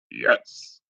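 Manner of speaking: whispered deep-voiced answer from the little cat; the tiny cat replies in a deep man's voice. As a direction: whisper it like a big grown man. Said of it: "Just in a really deep man's voice".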